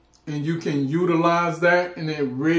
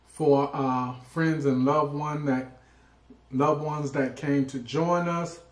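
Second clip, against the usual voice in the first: soft, quick grace before a meal; prayer; 6 dB quieter than usual. Acted. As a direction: pray over the food softly. Leads blessing before the meal.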